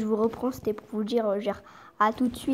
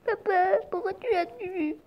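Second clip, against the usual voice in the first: high whiny child voice with a fake sob; mock crying child; 8 semitones higher than usual. Child asking dad why he killed the chicken, mock sob. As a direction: wail like a kid who lost a pet.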